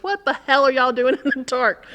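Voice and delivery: loud, high scolding yell; a mother's loud retold yell at teens in the dark; mom yelling. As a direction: shout at the kids like a startled mother. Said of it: "her mother goes".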